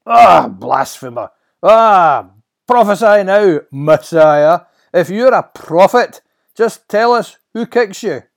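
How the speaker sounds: very loud, high taunting shouts; mock the prisoner as you beat him; jeering guards. Temple guards humiliating their prisoner, jeering shouts.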